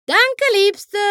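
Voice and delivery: high, bright-voiced cowpony; cheerful thanks. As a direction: toss off a happy thank-you. Applejack bedankt sich fröhlich. (fröhlicher, hoher Dank)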